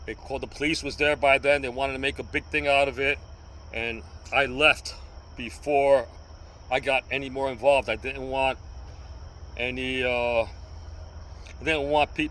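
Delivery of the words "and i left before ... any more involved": ordinary delivery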